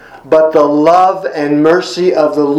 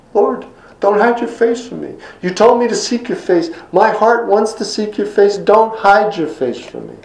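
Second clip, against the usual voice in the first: pleading David; 6 semitones higher than usual. Acted. David's plea voiced by preacher.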